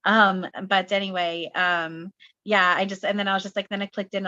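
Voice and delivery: normal talking voice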